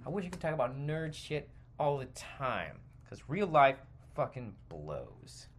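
ordinary delivery

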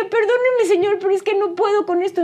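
high, tearful plea to the widower; tearful apology. Retold or imitated. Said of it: "los dos empezamos a llorar". (disculpa llorosa al señor, voz mucho más aguda)

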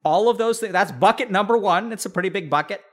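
normal talking voice

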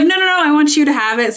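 high, loud, quick mimicked protest; insistent quote. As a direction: insist generously, acting it out. Acting out bookseller's insistence.

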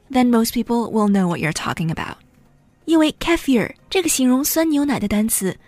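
ordinary talk, nothing marked

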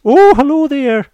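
very high, loud, drawn-out mock hello; hammed-up panicked shout in a put-on voice. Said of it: "a panicked Danny apparently shouts".

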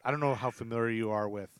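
ordinary delivery

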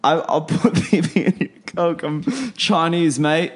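high cockney caricature with laughs; laughing fake British accent; mock British accent. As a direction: ham up a cockney bloke. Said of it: "British halfway through".

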